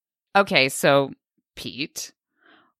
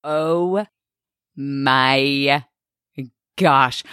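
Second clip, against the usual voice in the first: slow, drawn-out words with long pauses; pained disbelief; 4 semitones lower, much slower than usual. Real, not acted. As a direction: stretch out each word in dismay. Pained reaction to friend's story.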